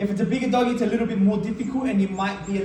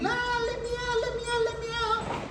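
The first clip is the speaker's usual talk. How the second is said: high, thin, mock whimpering plea; mock crying puppy; imitated dog whine; 6 dB quieter than usual. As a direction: whine like a crated puppy begging. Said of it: "like, crying".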